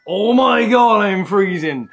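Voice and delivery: loud gasping cry of cold; cold shock shout. Real, not acted. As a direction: yell from the icy water.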